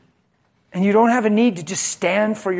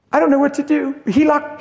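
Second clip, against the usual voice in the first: higher, distressed wife calling a friend; mock-upset wife, higher; upset wife on the phone; 9 semitones higher, 4 dB louder than usual. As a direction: plead to a girlfriend, pitched up. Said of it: "you call your girlfriend".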